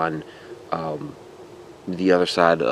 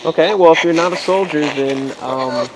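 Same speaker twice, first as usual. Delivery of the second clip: calling out; 6 semitones higher than usual. Real, not acted. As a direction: project your voice into the dark woods. Calling out to spirits.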